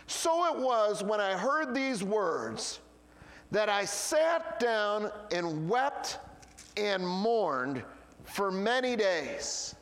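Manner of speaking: slow, deliberate reading of the verse; scripture reading. Acted. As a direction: read it out slowly and solemnly.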